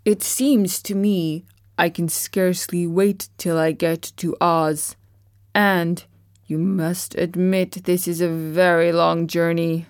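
tin man voice. A narrator's reading. Tin Woodman speaks.

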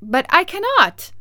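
put-on girl cries out in disbelief; surprised exclamation. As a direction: blurt it out in shock. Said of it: "exclaimed Dorothy, greatly surprised".

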